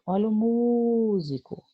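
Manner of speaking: MÚSICO chamado devagar, com o "mú" bem esticado; sílaba tônica alongada. Shown as a demonstration. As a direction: segure longamente o MÚ de "músico", como quem anuncia a palavra. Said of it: "eu tento vender a palavra". ("músico" cantado devagar, segurando a primeira sílaba)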